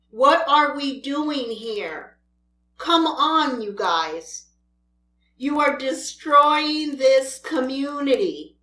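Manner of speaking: ordinary talk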